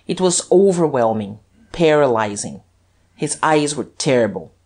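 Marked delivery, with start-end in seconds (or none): none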